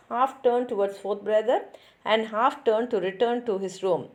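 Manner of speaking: plain reading voice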